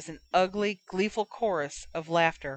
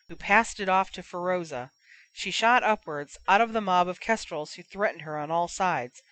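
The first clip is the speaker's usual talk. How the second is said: plain reading voice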